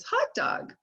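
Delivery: put-on "Hot dog" blended in a high breathy child voice; imitating a toddler. Grandson blends "Hot dog" back, high toddler voice. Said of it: "and he says".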